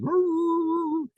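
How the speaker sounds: high howling roo roo like a dog; dog howl imitation. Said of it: "she does this thing where she goes".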